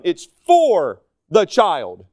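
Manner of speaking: "for" stretched long and strong; stress on FOR. Real, not acted. Preacher concluding on discipline, drawn-out stress on "for". Contrast for versus to the child.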